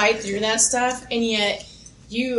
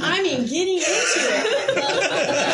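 giggling as she admits it; laughing; 9 semitones higher, slower than usual. Real, not acted. Admitting to the host she fights with Boston, laughing throughout. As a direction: talk through real laughter, pitched up.

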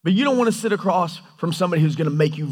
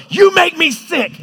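high, loud, drawn-out angry shout; angry spouse; 12 semitones higher, 7 dB louder, slower than usual. Acted. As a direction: yell it at a spouse mid-fight. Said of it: "with a fight with your spouse".

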